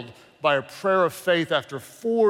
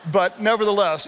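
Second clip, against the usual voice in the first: ordinary talk, nothing marked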